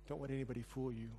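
ordinary delivery

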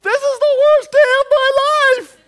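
put-on high, very loud miserable kid's yell; huge mock-anguished scream; yelled outburst. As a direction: yell it out in full-blown misery, imitating a fed-up kid. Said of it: "he yells out".

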